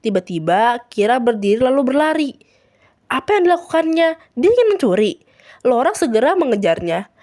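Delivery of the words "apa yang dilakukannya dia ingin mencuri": high, loud startled outburst; acted shock, pitched up; alarmed. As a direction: exclaim in disbelief at a theft. Laura alarmed at the theft.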